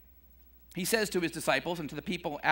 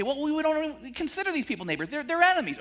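a hint of indignant objectors, pitched up; mock protest; 8 semitones higher, faster than usual. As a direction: lightly play the listeners who refuse. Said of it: "then they say".

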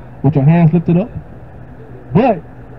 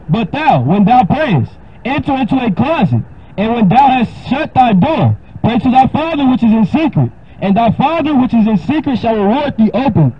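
raised, solemn King James reading; scripture reading; 6 semitones higher than usual. Acted. Preacher reading Matthew six to a caller, solemn. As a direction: read it like holy writ.

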